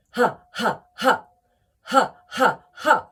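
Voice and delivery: sharp, short, loud ha bursts from the belly; deliberate ha. Shown as a demonstration. Coach showing the sharp ha exercise, deliberate loud ha. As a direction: punch out each ha like a laugh. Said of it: "two lots of ha".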